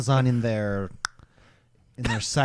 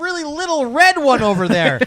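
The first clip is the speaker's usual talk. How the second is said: high, raised-voice comic warning; mock alarm; 11 semitones higher, 5 dB louder than usual. Tech warning about the little red server, mock-alarmed. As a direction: point it out in loud mock panic.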